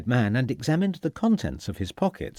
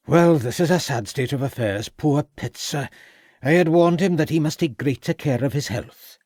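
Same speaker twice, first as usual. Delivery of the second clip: a hint of a Welsh lilt, a little higher; Welsh accent; 5 semitones higher, 3 dB louder than usual. A narrator's reading. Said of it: "in a pronounced Welsh accent".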